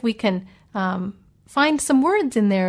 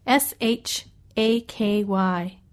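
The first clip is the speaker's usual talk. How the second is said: spelled out; slower than usual. Shown as a demonstration. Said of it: "spells the word aloud".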